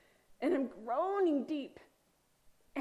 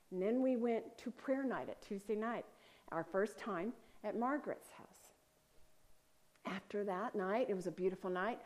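ordinary talk, nothing marked

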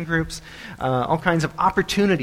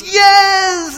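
high, loud drawn-out cheer; cheering crowd; 9 dB louder than usual. Acted. Crowd eager to see the tightrope walk, screamed cheer. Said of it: "they all cheer and scream".

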